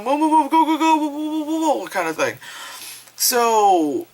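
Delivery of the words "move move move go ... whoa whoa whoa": high-pitched frantic chanting; mock panic. Guys fleeing in the car, high mock panic. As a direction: urge them on in a hammed-up panic.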